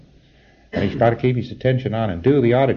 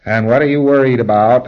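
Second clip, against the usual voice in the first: louder, pressing question to the client; forthright auditor question; 3 semitones higher, 9 dB louder than usual. Retold or imitated. Auditor demanding the PC's worry, louder. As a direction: push the question home. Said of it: "I say very forthrightly".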